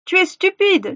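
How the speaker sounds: high, scornful exclamation; exasperated insult. A narrator's reading. Witch to Gretel feigning ignorance, exasperated.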